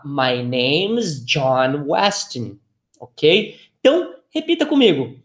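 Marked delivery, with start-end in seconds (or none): 0.1-2.6 each word of My name's John Weston slow and clear for learners; very slow model phrase. Shown as a demonstration. Teacher modelling a phrase for students, very slow and clear. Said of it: "Eu vou falar".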